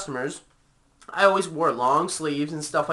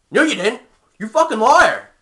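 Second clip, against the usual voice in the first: loud, higher-pitched retort; angry accusation; 7 semitones higher, 7 dB louder than usual. Retold or imitated. Boss calling an employee out in front of everyone, angry accusation. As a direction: throw the accusation out, louder and higher.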